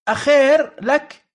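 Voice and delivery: mock Bedouin warning; Bedouin dialect. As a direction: drawl أخير لك like a Bedouin. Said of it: "في لهجة البدو".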